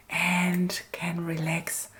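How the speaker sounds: soft, unvoiced whisper. Real, not acted. Whispering a calm farewell to viewers after her collage. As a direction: whisper a gentle goodbye to the viewers. Signing off the craft video.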